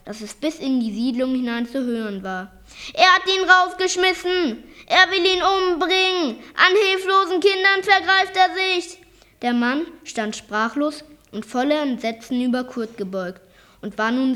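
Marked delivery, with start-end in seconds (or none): 2.9-9.0 high, loud shouts of outrage; loud screaming. A narrator's reading. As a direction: scream it for the whole neighbourhood to hear. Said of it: "schrien nun ebenfalls so laut". (laut und hoch geschrien)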